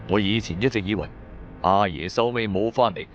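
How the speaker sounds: ordinary talk, nothing marked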